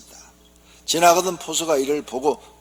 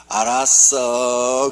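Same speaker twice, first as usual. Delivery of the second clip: very slow, louder drawn-out reply; drama hero answer; 8 dB louder, much slower than usual. Acted. Seoul husband playing along, slow drama reply. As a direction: stretch it out like a TV drama lead. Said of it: "서울 남편이".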